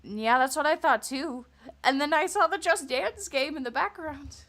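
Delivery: mock agreement, dripping with sarcasm; sarcastic. Real, not acted. Streamer mocks the video's excuse, loud sarcasm.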